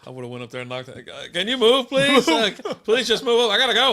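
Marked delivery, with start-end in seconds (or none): 1.3-3.9 much higher, whiny, louder pleading; hammed-up panicky begging; mock whiny plea. Begging the drive-thru car to move, high mock whine.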